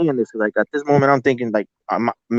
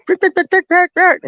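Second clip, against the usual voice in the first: high, louder yipping like playful dogs; imitated yipping; 5 dB louder than usual. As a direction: yip it out like a pack of happy canines. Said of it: "yippering, hollering".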